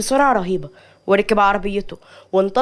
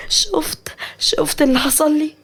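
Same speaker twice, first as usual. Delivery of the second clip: high, sad, tearful voice; acted crying complaint; 7 semitones higher, slower than usual. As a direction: start in a broken whisper, then plead tearfully. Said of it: "مليكة بحزن ودموع".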